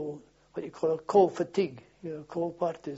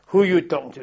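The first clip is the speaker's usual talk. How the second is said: loud, indignant challenge; retold sharp rebuke; 13 dB louder than usual. Sergeant rebuking a recruit for familiarity, loud retold challenge. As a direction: pull a cheeky recruit up hard.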